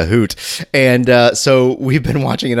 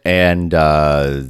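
usual speaking voice

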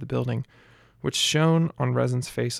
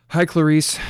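deadpan; 4 semitones higher, 7 dB louder than usual. Acted. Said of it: "Lucia deadpanned".

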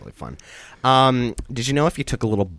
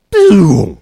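loud high boom; error sound boom; 9 semitones higher, 14 dB louder than usual. Retold or imitated. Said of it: "And it goes".

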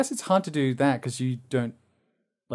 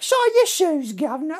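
very high, loud Cockney urchin; squeaky hammed-up Cockney British; lower-class British accent; 4 dB louder than usual. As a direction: pipe up like a cartoon Cockney street seller. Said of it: "different class British".

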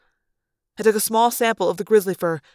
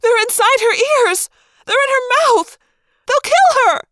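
very high, loud panicked cries; Cassie; 7 dB louder than usual. A narrator's reading. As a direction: escalate in terror for a friend. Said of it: "Cassie cried when she saw me".

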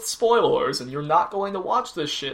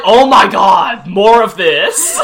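exasperated; 2 semitones higher, 10 dB louder, slower than usual. Retold or imitated. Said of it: "He's just like".

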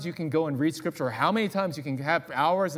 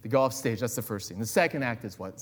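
usual speaking voice

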